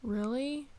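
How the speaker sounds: usual speaking voice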